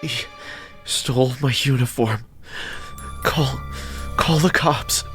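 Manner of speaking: pained, tearful voice with long gaps; painful sobbing voice. A narrator's reading. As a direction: force out broken words through pain. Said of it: "in a painful, sobbing voice".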